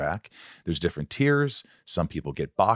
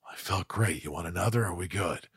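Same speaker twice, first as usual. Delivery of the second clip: soft, laconic tough-guy voice; hushed star-director impression; Clint Eastwood; 3 semitones lower, 7 dB quieter than usual. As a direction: keep it quiet and matter-of-fact. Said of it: "listen to you doing Clint all day long".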